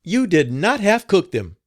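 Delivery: high, loud angry scolding. A narrator's reading. Madam scolds the cook over her cooking, furious and loud. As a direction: berate the cook in a rage. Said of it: "said she".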